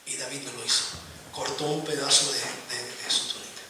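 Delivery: soft, low, almost whispered telling; hushed storytelling. A narrator's reading. Preacher to congregation telling David in the cave: hushed, low voice. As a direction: draw them in with a hush.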